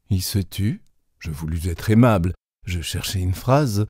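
ordinary delivery

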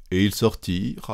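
usual speaking voice

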